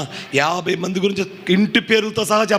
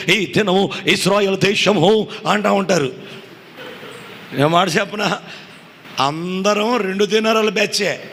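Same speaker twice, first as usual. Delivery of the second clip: high, ringing pulpit voice; full-force Telugu sermon delivery; fiery preaching; 5 semitones higher than usual. Acted. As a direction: preach it out to the congregation. High fervent preaching stretch.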